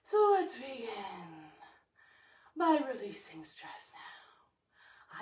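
slightly winded and breathy; out of breath. Real, not acted. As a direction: talk while catching your breath. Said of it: "I might sound a little out of breath".

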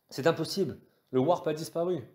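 high-pitched alarmed navigator; alarmed disbelief. A narrator's reading. Navigator panics, warp has vanished. (voix aiguë, incrédule et alarmée)

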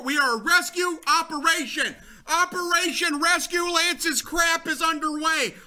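high, proclaiming shout; mock mission announcement. Player announcing a rescue operation, mock-heroic shout. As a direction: declare the operation like a grand mock commander.

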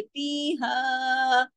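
very slow फीहा with long held vowels; four-count madd. Shown as a demonstration. Teacher shows the madd to pupils, फीहा drawn out long. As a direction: stretch the vowels of फीहा steadily. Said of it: "चार हरकत खींच के पढ़ती है".

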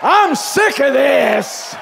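slow, loud, high yell of resolve; shouted declaration. Shown as a demonstration. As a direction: yell it as the crowd should. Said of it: "to yell at me".

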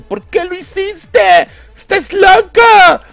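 very high, drawn-out mock shriek; hammed-up falsetto outrage, slow and loud; Wonder Woman. Confronting Superman over the killings, in a mock outraged shriek. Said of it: "Wonder Woman ve el matazón ... le dice".

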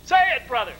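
high, insistent command; retold shouted urging. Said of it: "he shouted it again".

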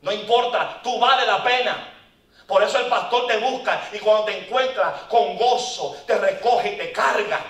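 loud, high, insistent preaching; preaching shout. Real, not acted. Preacher to the men, a loud, high declaration. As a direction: press the point to the men loudly.

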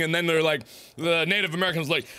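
normal talking voice

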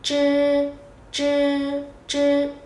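"chư" tròn miệng, lưỡi cong; "chư" không bật hơi, lưỡi cuộn; tiếng Trung zh. Shown as a demonstration. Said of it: "không bật hơi". ("chư" cong lưỡi không bật hơi)

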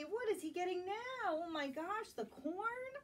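usual speaking voice

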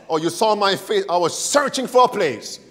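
normal talking voice

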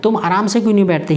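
ordinary talk

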